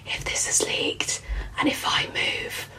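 hushed terrified whisper. Retold or imitated. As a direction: breathe it like you dare not move.

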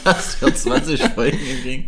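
slow, laughing delivery. Real, not acted. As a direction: laugh through the words.

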